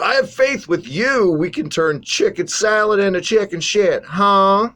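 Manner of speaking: high, loud burst of enthusiasm; hyped-up rallying. Real, not acted. Host rallying co-host despite a lackluster show, hyped up. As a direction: pump up your partner, pitch riding high.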